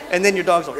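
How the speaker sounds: ordinary delivery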